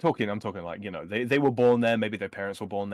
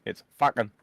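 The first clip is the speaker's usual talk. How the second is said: Bogan accent; 4 dB louder than usual. Shown as a demonstration. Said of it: "for Bogan".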